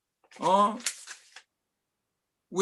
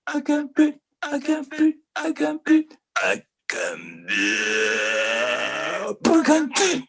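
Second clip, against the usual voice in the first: triumphant cry; faster than usual. Acted. Poet's climax, crying out to the audience. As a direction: proclaim it again and again.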